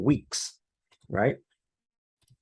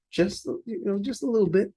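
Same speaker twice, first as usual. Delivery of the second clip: much higher, coaxing; 12 semitones higher than usual. Real, not acted. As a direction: raise the voice way up, playfully. Minimizing the move upward.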